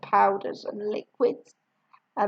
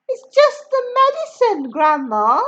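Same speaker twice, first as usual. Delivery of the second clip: put-on higher, calm-ish boy's voice; George reassuring; 9 semitones higher, 4 dB louder than usual. A narrator's reading. George calming Grandma, higher boy's voice. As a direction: brush it off lightly. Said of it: "George said".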